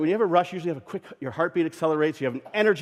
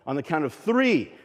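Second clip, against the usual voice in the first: higher and louder, building up; building count to a shout; 5 semitones higher, 5 dB louder, slower than usual. Acted. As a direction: build up to a burst. Count-in to rush.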